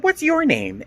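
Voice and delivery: retelling confronting the tester, high and indignant; pointed demand. Retold or imitated. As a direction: fire the question back at her. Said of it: "And I said".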